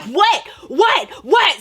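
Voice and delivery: high, loud repeated challenge; punchy acted-out fight taunt; squaring up. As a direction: throw each word out like a dare.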